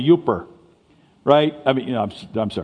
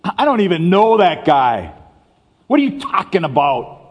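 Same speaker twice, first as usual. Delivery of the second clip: indignant denial; 7 semitones higher, 5 dB louder than usual. Retold or imitated. Peter denying Jesus, contrasted.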